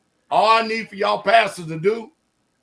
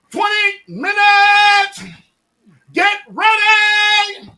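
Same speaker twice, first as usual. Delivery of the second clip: loud, slow drawn-out jump commands; jumpmaster barking cues; 10 semitones higher, 8 dB louder, slower than usual. Retold or imitated. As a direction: bellow each command across the plane. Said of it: "we're gonna holler".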